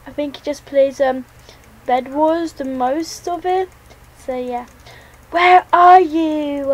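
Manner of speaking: usual speaking voice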